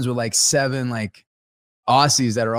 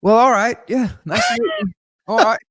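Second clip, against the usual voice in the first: awkward polite brush-off; 9 semitones higher, 6 dB louder than usual. Retold or imitated. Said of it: "Then he goes".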